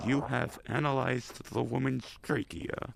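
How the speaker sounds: slow mock computer announcement; put-on robotic announcer; machine announcer voice. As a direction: read it out slowly like an automated machine.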